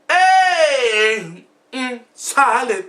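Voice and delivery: high crying shout, then slow; hammed-up lopsided wail; crying out about a solid mouth; mock wail. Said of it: "talking like this".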